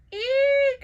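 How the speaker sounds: very high, loud terrified shriek; shouted eek. Acted. Child Pancake shrieking in terror at Waffle. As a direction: shriek in fright. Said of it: "shouted Pancake".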